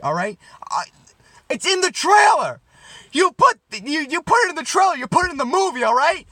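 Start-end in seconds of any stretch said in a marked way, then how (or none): 1.5-6.3 high, loud comic outrage; outraged shout. Acted. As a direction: yell it like a ranting fan. Outraged rant about cut trailer joke.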